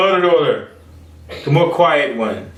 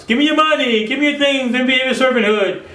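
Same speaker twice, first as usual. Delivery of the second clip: mocking greedy demand; 11 semitones higher than usual.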